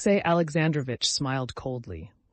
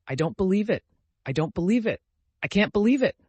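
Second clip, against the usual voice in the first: disbelieving; 7 semitones higher, 7 dB louder than usual. A narrator's reading. Dolly refusing to believe.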